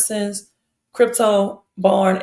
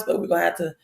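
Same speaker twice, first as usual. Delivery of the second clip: ordinary delivery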